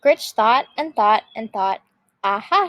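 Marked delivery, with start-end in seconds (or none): none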